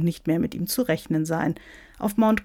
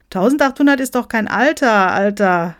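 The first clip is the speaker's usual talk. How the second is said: loud, higher cheeky quip; smirking; 4 semitones higher, 8 dB louder than usual. Acted. Someone teases the ancient bishop, smirking. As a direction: crack it with a smirk. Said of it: "feixte jemand".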